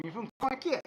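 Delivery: high, teasing imitation of locals; mocking high voice. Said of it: "Concord used to be a joke".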